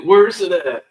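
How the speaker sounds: loud, disbelieving challenge; incredulous. Real, not acted. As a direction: demand it, exasperated. Mocking absent battle response.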